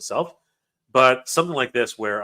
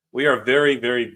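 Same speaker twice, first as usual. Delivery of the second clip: ordinary talk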